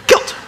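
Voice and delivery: "Kilt" whispered through a laugh, short i and hard t; country pronunciation. Shown as a demonstration. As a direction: repeat "Kilt" on a breathy chuckle. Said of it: "K I L T".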